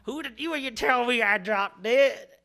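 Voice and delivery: loud, high, drawn-out hammed-up indignation; mock outrage. Improviser confronting a partner who criticized the accent, mock outrage. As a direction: blow up slowly in exaggerated protest.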